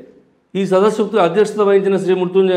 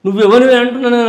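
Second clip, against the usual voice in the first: raised, louder demand; furious who-are-you demand; angry challenge; 5 semitones higher, 6 dB louder than usual. Real, not acted. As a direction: confront the rival head-on, voice up. Heated political rant at a rival.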